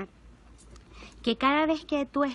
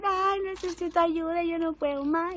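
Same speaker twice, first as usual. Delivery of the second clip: high, slow, broken plea; pleading for help; 5 semitones higher, slower than usual. Retold or imitated. To her father, a weary plea for help. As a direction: beg as if at the end of your rope. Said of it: "un día decir".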